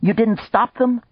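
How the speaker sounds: high, loud dismayed question; cried out. Acted. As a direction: cry it out in disbelief. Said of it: "Maya cried".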